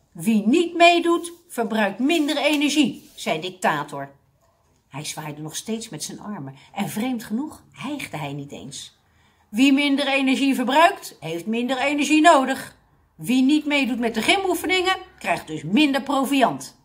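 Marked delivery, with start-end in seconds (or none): 0.1-3.1 loud, high drill-instructor pronouncement; commanding. A narrator's reading. Dictator warning those not joining in, commanding. Dictator lays down rules to class. 9.5-16.8 loud, high drill-instructor pronouncement; commanding. A narrator's reading. Dictator warning those not joining in, commanding. Dictator lays down rules to class.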